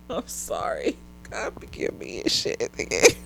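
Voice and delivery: soft unvoiced sorry under the breath; whispered apology. Real, not acted. After mocking his height, whispered real apology. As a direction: whisper it, half guilty.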